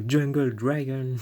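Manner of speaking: hammed-up English accent. Shown as a demonstration. Showing the English name sounds better, showy English accent. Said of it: "dire les noms en anglais".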